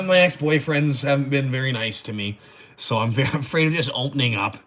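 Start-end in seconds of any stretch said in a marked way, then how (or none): none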